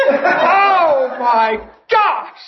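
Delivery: high, drawn-out imitation of dismay; dismayed mock groan. Husband being yelled at, mock dismay. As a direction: stretch each word, pitch swooping up and down.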